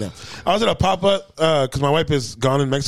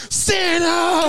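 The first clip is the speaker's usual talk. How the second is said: high shouted call with a laugh; excited shout; 13 semitones higher than usual. Retold or imitated. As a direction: yell for Santa like a thrilled kid. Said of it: "waits till Santa walks by and goes".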